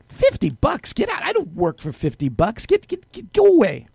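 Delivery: high-pitched, scornful brush-off; acted indignation. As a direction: shoo him away in mock outrage. Acted indignant prostitute rejecting an offer.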